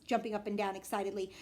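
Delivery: ordinary delivery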